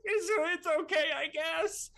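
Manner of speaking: high, loud tearful squeak; hiding tears. Acted. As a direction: feign indifference while choked up. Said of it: "you see little tears sort of dropping".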